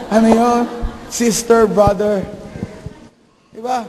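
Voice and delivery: ordinary talk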